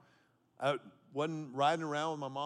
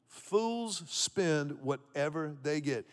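slow, every word weighed, stress on Fools; slow, deliberate restatement; slow emphatic repeat; 2 semitones lower, much slower than usual. Acted. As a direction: drive home Fools spend whatever they get slowly. Repeats proverb slowly for emphasis.

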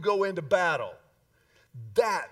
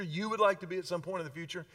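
ordinary delivery

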